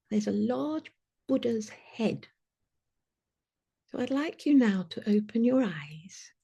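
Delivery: walk them gently into the scene; guided visualization. Acted. Leader guiding the group into an imagined arrival, hushed.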